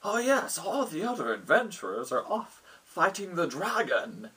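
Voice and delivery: high, swooping guard voice; town guard. Acted. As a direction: play a guard breaking news to newcomers. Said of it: "one of the guards".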